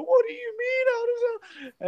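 very high, crying sobbed questions; imitating a sister bawling, high and tearful; mock bawling sister. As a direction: cry it out like a shocked little girl. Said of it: "my sister starts bawling".

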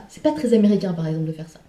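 usual speaking voice

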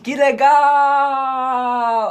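a long, drawn-out, sarcastic "Que legal!". Retold or imitated. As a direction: fake cheer as the car dies. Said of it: "Aí eu disse".

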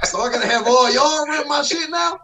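shouting through laughter; laughing shout. Real, not acted. Laughing rant about presidency.

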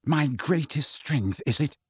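understated trembling anger; slow, held-back bitter retort; shaking voice. A narrator's reading. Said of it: "his voice shaking".

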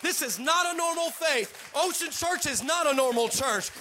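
high, loud, driving preacher delivery; rally the congregation at full voice; full-force preaching. Real, not acted.

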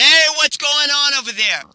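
high, loud call of an annoyed manager; indignant shout. Acted. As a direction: yell across at the noisemaker. Hotel manager breaks in on noise.

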